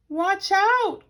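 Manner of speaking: high, loud warning cry. A narrator's reading. Jonathan warning Paddington, loud high cry. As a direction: yell it in alarm. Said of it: "cried Jonathan".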